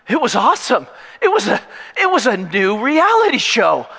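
high, loud mock enthusiasm; hammed-up reality show excitement; excited hype. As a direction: pitch it like a new TV show. Hyping prison as reality show.

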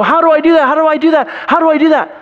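high, loud mock fretting; whiny imitation. Preacher mocking an anxious believer, high whine. Mocking people who want great things.

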